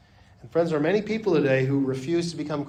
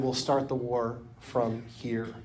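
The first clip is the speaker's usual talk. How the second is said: quiet retelling; 4 semitones lower, 7 dB quieter, slower than usual. Retold or imitated. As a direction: lower and softer, slow and solemn. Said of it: "but he said".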